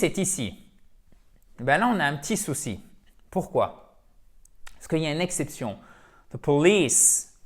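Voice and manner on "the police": The police slow and loud, police with a long i; stress on police. Shown as a demonstration. Teacher modelling the police with its stressed long i. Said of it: "toujours accent tonique ici".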